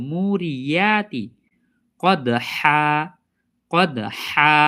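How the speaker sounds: very slow মুরিয়াতি with long মু and full ইয়া; careful recitation. Shown as a demonstration. Teacher modelling the correct syllable, careful recitation. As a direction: stretch মুরিয়াতি and hold the হা of কদহা. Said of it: "ইয়া উচ্চারণ করব".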